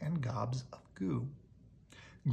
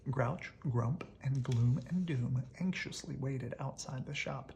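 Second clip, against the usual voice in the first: ordinary delivery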